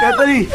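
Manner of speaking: anguished cry. Acted. Emotional clash after kiss.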